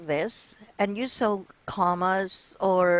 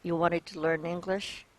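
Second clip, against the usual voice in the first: flat and low-energy, softer, no lift; monotone; 3 semitones lower, 6 dB quieter than usual. Shown as a demonstration. Teacher demonstrating a monotone question to her student. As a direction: drag it out dull and lifeless. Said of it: "So that's being monotone".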